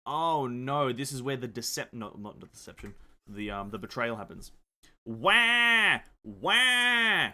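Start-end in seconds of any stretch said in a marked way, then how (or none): 5.0-7.3 loud high wailing sobs; crying wail. Acted. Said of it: "that was a good crying impression".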